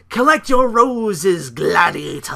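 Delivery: slow, high, loud taunt; mock shout. Bray mocking Roman, slow loud shout. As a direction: spit out the taunt. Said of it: "He's like".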